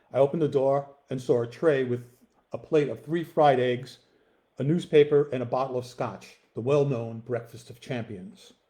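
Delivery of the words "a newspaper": usual speaking voice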